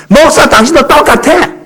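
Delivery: a deacon rounding on the pastor in a high, slow, heated cry; retold angry yell.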